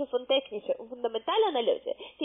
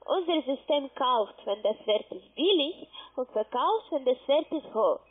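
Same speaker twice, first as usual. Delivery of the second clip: slower, spelled-out explaining; slowly. Real, not acted. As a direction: say it slowly for a confused listener. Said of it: "noch mal ganz langsam".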